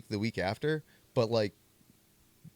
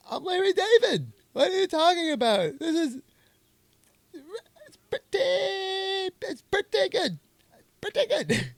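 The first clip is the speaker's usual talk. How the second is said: much higher, loud, whiny Larry David; hammed-up Larry David, far higher and louder; Larry David impression; 5 dB louder than usual. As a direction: squeal it like a cartoon Larry David, stretching pretty. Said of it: "doing impressions".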